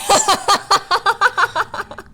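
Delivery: high loud fit of laughter; cracking up at yourself; burst of laughter. Real, not acted. Breaking down over her own bad accent, loud high laugh.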